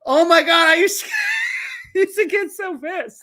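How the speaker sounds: loud, high, laughing through the words; laughing hard. Real, not acted. Streamer recalling how pissed he used to get, laughing. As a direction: crack up while recalling it. Laughing at memory of old anger.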